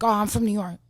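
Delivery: put-on a little boy boasting about his hometown; proud son quote. Son showing off to mom about New York, loud and proud. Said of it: "my son will be the first one to be like".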